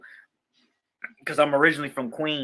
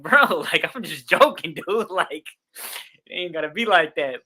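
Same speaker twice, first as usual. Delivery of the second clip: nervous laughing; 3 semitones higher, 3 dB louder than usual. Retold or imitated. Calming the angry customer, laughing.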